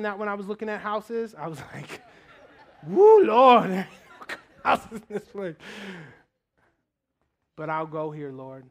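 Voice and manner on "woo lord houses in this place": loud woo breaking into laughter; laughing woo. Retold or imitated. Pastor telling God about the house prices, laughing loudly. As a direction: laugh off the sticker shock to the Lord.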